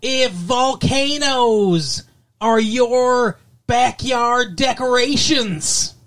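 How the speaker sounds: high, loud, very slow comedian setup; hammed-up joke setup. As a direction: stretch the setup out like a ham comic. Mock Foxworthy-style joke setup.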